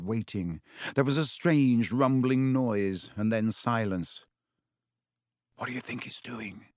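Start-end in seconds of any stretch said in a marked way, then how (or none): 5.6-6.7 very soft, fearful whisper; hushed, breathy question. A narrator's reading. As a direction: whisper so it won't hear. Hiding from the creature, terrified.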